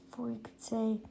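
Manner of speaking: breathy whispered aside. Real, not acted. Reader whispering to listeners before a tricky word. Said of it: "whispered aside".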